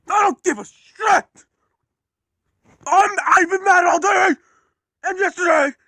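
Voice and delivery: high, loud, furious yell with a sob in it; angry crying shout. Acted. James screaming that he has been mad all day. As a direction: scream it out, fed up with the whole day.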